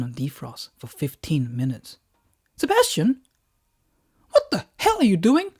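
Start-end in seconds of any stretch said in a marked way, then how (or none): 2.6-5.5 high, loud, shocked wife yelling at husband; a furious wife catching him red-handed, pitched high; wife shouting. Acted.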